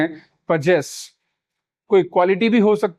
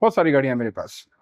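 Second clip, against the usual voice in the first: student's reply; 5 semitones lower, faster than usual. Retold or imitated. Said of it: "बोल देते हैं कि".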